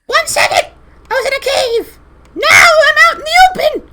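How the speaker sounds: very high, loud squeal; creature voice. Acted. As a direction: shout it in a tiny shrieky creature voice. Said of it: "he'll say".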